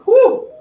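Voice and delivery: a high, loud whoop of celebration; bright celebratory whoop; high cheer. Real, not acted. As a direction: whoop for the finished set. Cheering after ten touches.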